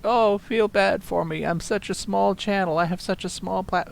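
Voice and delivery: sarcastic poor-me whine; mock self-pity. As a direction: mimic someone begging for pity. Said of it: "being like".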